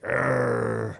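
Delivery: long mock dog growl; imitated dog growl. Owner imitating dog during back rub, growling. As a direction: grumble like an annoyed dog. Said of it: "Gus growls at us".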